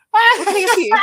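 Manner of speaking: retold customer service. Server answering the offended old lady, high customer-service voice. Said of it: "I was like, "Sorry?".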